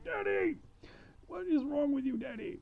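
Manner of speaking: put-on a fed-up girl scolding her dad; exasperated daughter. Daughter scolds her bragging father, whiny and high.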